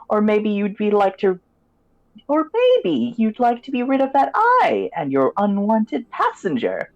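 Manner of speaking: high crone voice, sly and selling; sly old hag offering a bargain; witch voice. Acted. Crone continues her offer.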